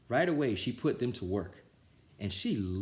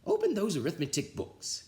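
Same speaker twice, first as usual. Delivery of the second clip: witchy teacher; 10 semitones higher than usual. A narrator's reading. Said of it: "snapped Missus Swamp".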